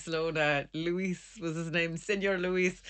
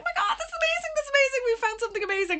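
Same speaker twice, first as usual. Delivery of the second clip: very high, giddy re-enactment; excited squealing; 4 dB louder, faster than usual. Retold or imitated. Two diggers dancing around over their find, giddy acted excitement. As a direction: squeal it in mock overexcitement. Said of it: "we got so excited".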